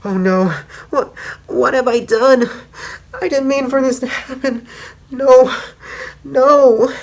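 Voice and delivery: high, loud, anguished cries; horrified realization. Retold or imitated. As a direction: break down as the horror sinks in. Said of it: "she starts to realize".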